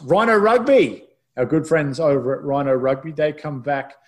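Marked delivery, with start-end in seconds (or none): 0.0-1.0 high, loud, drawn-out sponsor shout; hammed-up high announcer holler; big announcer call. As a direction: belt it out slowly like a hyped announcer. Host announces segment sponsor with flourish.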